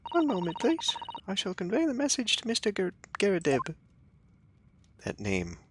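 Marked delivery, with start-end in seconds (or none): 0.1-3.7 high, polite maid voice; English maid voice. Acted. Maid asking visitors who is calling, high voice. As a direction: pitch well up and keep it proper. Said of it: "That was a real-life English maid".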